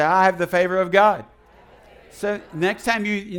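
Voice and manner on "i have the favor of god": declaration. Shown as a demonstration.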